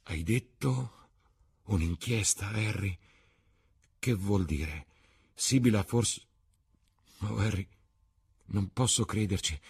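words squeezed through a tight throat; choked sobbing voice. A narrator's reading. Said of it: "singhiozzò con voce soffocata".

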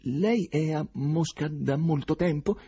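slow, shaky frightened question; trembling voice. A narrator's reading. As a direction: let the voice tremble with fear. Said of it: "chiese con voce tremante".

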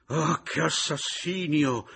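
high, loud, drawn-out lament; crying out. A narrator's reading. Widow exclaiming at the disaster, crying out. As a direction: wail it out at full voice, stretching the words. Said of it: "gridò la pescatore".

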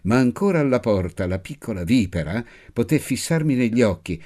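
plain reading voice

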